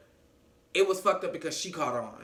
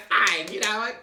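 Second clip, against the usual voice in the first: louder, higher huffy retort; mock huffy; 4 semitones higher, 5 dB louder than usual. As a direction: imitate someone storming off a call. Retelling an annoyed partner's exit.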